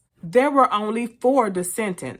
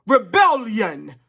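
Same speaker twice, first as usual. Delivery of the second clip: higher, slow, drawn-out proclamation; rallying cry; 4 semitones higher, 7 dB louder, slower than usual. Acted. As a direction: proclaim it like a call to revolt. Rallying cry closing the message.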